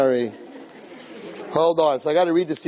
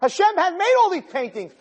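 preaching. Acted. Rabbi preaching to the man that God is not on trial. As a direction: preach it out with full force.

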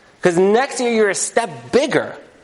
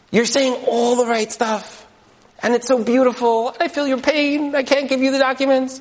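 sweet-talking refusal; 7 semitones higher than usual. Retold or imitated. Official refusing papers, sweetly.

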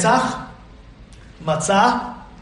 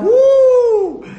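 excited woo; 7 dB louder than usual. Retold or imitated. Waiter presents steak.

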